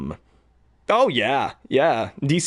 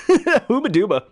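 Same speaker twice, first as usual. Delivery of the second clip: Sonic catchphrase; 11 semitones higher, 4 dB louder than usual. Retold or imitated. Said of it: "shout out to Belina Productions".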